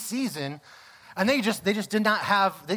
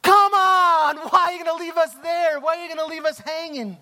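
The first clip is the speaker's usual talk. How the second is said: high, loud cry of frustration, easing off slightly by the end; outraged shout; 9 semitones higher, 6 dB louder than usual. Acted. Viewer yelling at the screen after a cliffhanger, exasperated shout. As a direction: explode in protest, pitch way up.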